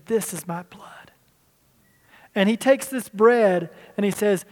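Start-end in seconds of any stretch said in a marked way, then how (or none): 0.1-1.1 softer and slow, reverent; hushed reverent words. Acted. Preacher voicing Jesus at the supper, hushed and slow. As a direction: let the words fall soft and slow.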